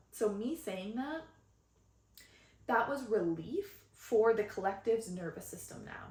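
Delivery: ordinary delivery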